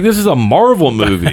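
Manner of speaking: high-pitched mock protest; retold exclamation. Retelling own dismay at crying over film.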